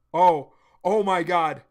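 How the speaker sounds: overwhelmed with hype; real loud amazement; awed outburst. Real, not acted. Reacting to the hero's power-up.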